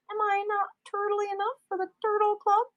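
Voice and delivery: high, put-on goofy voice for the quote; movie quote voice. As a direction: ham it up like a comedy movie character. Quoting a movie line.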